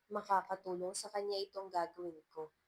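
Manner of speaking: usual speaking voice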